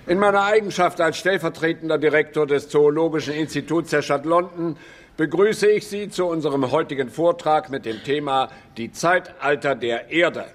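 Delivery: ordinary delivery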